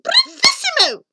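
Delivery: gleeful shriek falling to a gloat; delighted villain queen; crying out. A narrator's reading. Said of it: "The Queen cried out".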